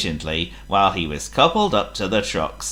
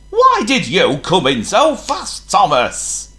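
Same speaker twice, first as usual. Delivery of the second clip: cross, loud boss voice; booming; 10 semitones higher, 5 dB louder than usual. A narrator's reading. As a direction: boom it out crossly. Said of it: "the Fat Controller boomed".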